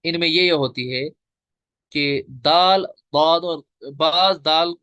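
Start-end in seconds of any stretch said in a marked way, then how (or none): 1.9-4.7 दाल and दाद spelled out slowly and louder, each letter kept distinct; teacher contrasting two letters; letter contrast demo. Shown as a demonstration. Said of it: "दाद को दाल की तरह पढ़ते हैं".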